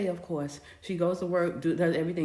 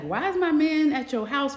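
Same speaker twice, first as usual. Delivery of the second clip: higher and louder, accusing; outraged; 8 semitones higher, 6 dB louder than usual. Retold or imitated. Daughter confronting her mother over the boyfriend, outraged. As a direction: demand it, shocked and furious.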